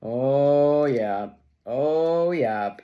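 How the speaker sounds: loud, drawn-out oh yeah; loud delight. Real, not acted. Reader cheers a good card with loud delight.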